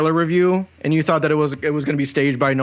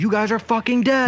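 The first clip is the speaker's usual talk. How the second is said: high-pitched threat in retold fury; mock rage; 7 semitones higher than usual. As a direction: imitate a hero flying into a rage. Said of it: "kind of rage before".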